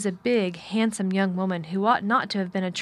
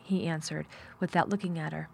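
ordinary delivery

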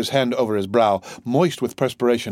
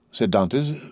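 ordinary delivery

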